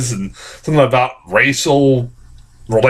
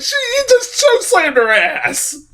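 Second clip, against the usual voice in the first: high, slow quote wobbling with laughter; laughing disbelief. Retold or imitated. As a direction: choke the line out through laughter. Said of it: "I'm like".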